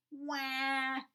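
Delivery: high loud wail; distressed wail. Acted. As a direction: let out a squashed little wail. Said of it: "he cried".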